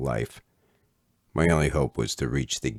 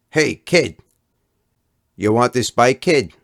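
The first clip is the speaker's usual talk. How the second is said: high, loud, cheerful call; friendly call; 10 semitones higher, 10 dB louder than usual. Acted. Fat kid calling to the boy outside the store, loud cheerful call. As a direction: shout it across the street. Monster calls out offering the bike.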